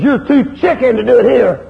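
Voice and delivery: preacher's loud rebuke; preaching shout. Real, not acted. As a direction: call them out from the pulpit. Preacher challenging the congregation.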